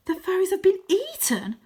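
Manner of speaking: high, loud shocked gasp of a question; high breathy shocked question; shocked whisper. Acted. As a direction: react in disbelief.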